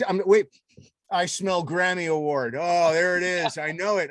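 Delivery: normal talking voice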